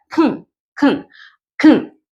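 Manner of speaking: "kn" with the vowel swallowed; weak form kn. Shown as a demonstration. Teacher showing the reduced "can" to students. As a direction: clip "kn" to just k and n. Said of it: "nos queda kn".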